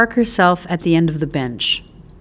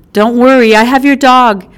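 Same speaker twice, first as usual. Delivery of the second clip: reassuring; 5 semitones higher, 7 dB louder than usual. Acted. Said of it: "Izzy assured her".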